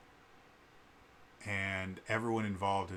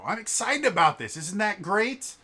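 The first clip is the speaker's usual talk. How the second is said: high, loud burst of enthusiasm; unabashed excitement; 12 semitones higher, 12 dB louder than usual. Acted. Passionate people share what they love with others, unabashed excited shout. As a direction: shout it with gleeful, unapologetic passion. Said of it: "completely unabashed".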